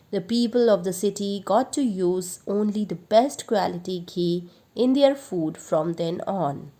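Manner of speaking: plain reading voice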